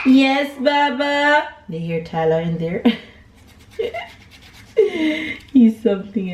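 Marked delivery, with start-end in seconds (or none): 0.1-1.5 calling back. Real, not acted. Parent answers a child calling mid-cooking, calling out. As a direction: answer brightly across the house, raised pitch.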